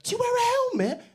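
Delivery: squeaky-high falsetto busybody; mock questioner. People patronising a cyclist, mocked in high falsetto. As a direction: mimic a fussing questioner in falsetto. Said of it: "We get people asking".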